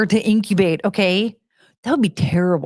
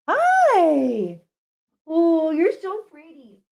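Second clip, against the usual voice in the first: much higher, slow cooing pet voice; 10 semitones higher, much slower than usual. Real, not acted. Cooing at the dog.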